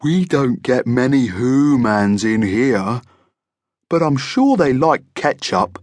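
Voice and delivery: drawn-out genial monster voice; friendly monster, musing. A narrator's reading. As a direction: muse as a friendly monster host. Monster figure in narrated story.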